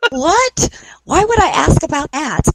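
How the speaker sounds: high, incredulous self-mocking yelp; retold disbelief. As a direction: replay your disbelief in a high voice. Mocking own question to the spirit.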